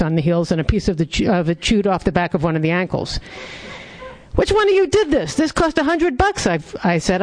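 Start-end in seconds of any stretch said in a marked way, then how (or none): 4.3-6.4 high, sharp, rising anger; angry outburst. Acted. Dog owner confronting his dogs over chewed boots, angry. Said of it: "as my anger started to build".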